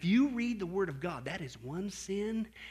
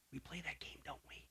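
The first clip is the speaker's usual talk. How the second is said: whispered aside; 4 semitones lower, 19 dB quieter than usual. Real, not acted.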